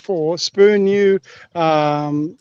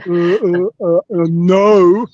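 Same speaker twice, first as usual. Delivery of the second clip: mock Stephen Hawking; 4 dB louder, much slower than usual.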